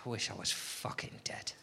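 breathless, near-silent "I wish I was fucking dead"; half-laughing whispered gloom; despairing mutter. Pretend. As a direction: mutter it under your breath in mock despair. Lunchtime despair, inner thought.

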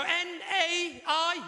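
letters drawn out one by one; N A I spelled slowly, each letter held; slow spelling out. Shown as a demonstration. Spelling out her name slowly to the Irish girl. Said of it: "Right, let me have a go".

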